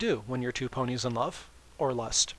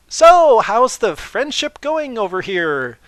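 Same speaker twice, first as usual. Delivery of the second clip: much higher and louder cheery barge-in; bright loud intrusion; 10 semitones higher, 14 dB louder, slower than usual. Acted. Twilight bursting into the compartment, loud and chirpy. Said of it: "door slammed open".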